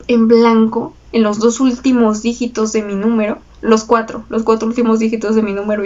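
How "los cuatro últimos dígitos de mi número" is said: ordinary delivery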